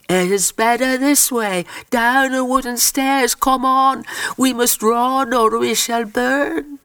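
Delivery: high, sustained, insistent cry; urgent plea. A narrator's reading. Dolly Duffy urging the girls down burning stairs, urgent high plea.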